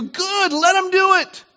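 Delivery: emphatic concession. Acted. Acted speech of Boaz conceding.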